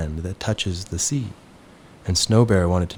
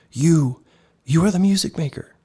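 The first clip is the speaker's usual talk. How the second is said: trembling cry; 10 semitones higher, 4 dB louder than usual. A narrator's reading. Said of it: "he cried in a trembling voice".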